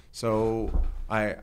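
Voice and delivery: normal talking voice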